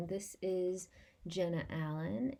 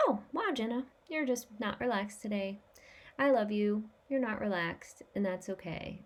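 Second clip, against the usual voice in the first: higher, gently accepting voice; kind self-talk; 4 semitones higher than usual. Acted. Showing kind self-talk when not relaxed, accepting and affectionate. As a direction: reassure yourself kindly, pitched up. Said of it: "we don't need to be mean to ourselves".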